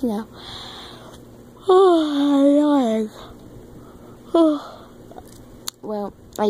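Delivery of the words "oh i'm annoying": exasperated sigh then a groan. Real, not acted. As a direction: sigh at yourself, fed up. Sighing at herself.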